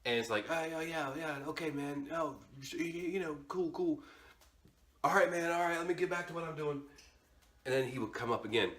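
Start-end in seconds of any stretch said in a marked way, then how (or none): 0.5-6.8 soft, higher, awkwardly agreeable; mumbled half-interest, easing out of the chat; polite brush-off. Retold or imitated. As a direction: humour him while backing away. Politely brushing off the pest, retold.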